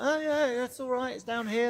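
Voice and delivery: high, breezy mock guide voice; a chirpy, high sure-footed guide; imitating a confident guide. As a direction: toss it off like a guide who knows the way. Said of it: "We'd have been like".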